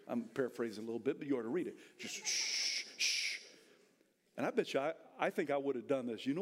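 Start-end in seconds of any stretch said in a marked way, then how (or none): 2.3-3.4 long hissed "shh"; crowd shushing. Acted. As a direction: shush someone twice. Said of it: "People around him started going".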